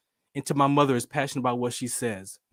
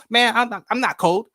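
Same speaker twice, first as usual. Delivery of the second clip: higher, louder mock protest; protesting son; 8 semitones higher, 5 dB louder than usual. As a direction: act out an exasperated grown son. Grown son protests to mom.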